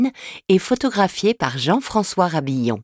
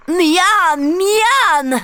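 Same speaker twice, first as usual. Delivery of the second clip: higher, greedy little ogre yum-yum; delighted ogre; 10 semitones higher, 3 dB louder than usual. Acted. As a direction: savour it like a hungry ogre child. L'ogre croque le toit. (petit ogre gourmand et ravi)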